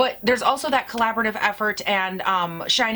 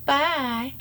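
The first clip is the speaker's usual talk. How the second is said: ordinary delivery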